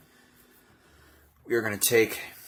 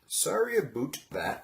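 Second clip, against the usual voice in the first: playful mock-Canadian accent, higher pitch; 8 semitones higher than usual. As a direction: put on a comic Canadian "sorry aboot". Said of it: "Canadians sound hilarious".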